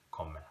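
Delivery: "comer" with the final r nearly dropped; soft R ending. Shown as a demonstration. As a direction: say "comer" as "ko-meh". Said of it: "it's not nearly as strong".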